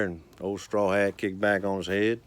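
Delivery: ordinary talk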